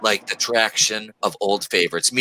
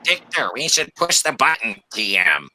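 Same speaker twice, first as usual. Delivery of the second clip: henchman voice. Pretend. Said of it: "dollar store dude talk".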